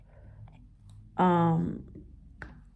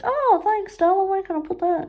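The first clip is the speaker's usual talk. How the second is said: high, quick, gushing mock voice; mimicking mum; 11 semitones higher than usual. Retelling mum grabbing the work, high and gushing. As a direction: coo it like the oblivious mum. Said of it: "I couldn't get over it".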